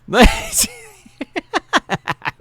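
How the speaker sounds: high, loud burst of laughter; real cackling laugh. Real, not acted. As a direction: crack up helplessly. Host cracks up at panelist's face.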